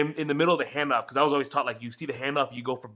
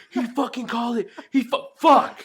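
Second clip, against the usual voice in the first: high, rising disbelief that turns loud; stunned outburst; 11 semitones higher, 6 dB louder than usual. Retold or imitated. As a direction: lose it as the prediction comes true. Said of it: "I was like".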